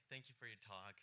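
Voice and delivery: ordinary talk, nothing marked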